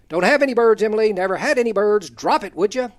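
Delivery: loud retold exasperation; exasperated brush-off. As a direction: shut down a child's nagging. Retold brushing off a persistent child.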